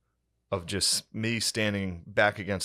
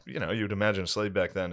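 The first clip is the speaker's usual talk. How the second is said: normal talking voice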